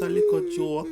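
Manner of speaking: high sheepish excuse; high pleading repeat. Acted. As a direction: squeak it up high to excuse himself. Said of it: "And I just a little joke".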